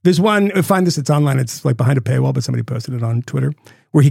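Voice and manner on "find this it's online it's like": ordinary delivery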